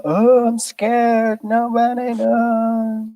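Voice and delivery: high, slow, far-off mock fright; mock scared voice. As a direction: play a frightened ninny, way up high. Mocking scared people.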